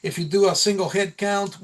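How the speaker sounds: ordinary talk, nothing marked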